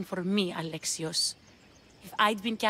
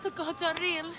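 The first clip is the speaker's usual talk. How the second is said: high, loud cry for help; terrified captive begging a stranger for help; desperate plea; 10 semitones higher, 4 dB louder than usual. Acted. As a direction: beg to be saved, frightened.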